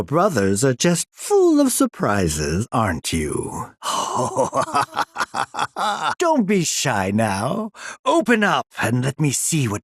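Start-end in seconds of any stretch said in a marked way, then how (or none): 3.8-6.1 high, drawn-out villainous chuckle; gloating villain laugh. Acted. Villain delighted by his specimen's surprises, gloating laugh. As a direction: laugh with relish at your catch.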